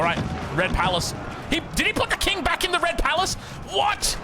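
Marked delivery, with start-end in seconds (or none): 1.5-4.2 high, incredulous shouts about the king; can't believe what he's seeing, voice pitched way up; real disbelief. Real, not acted. King moved back into danger.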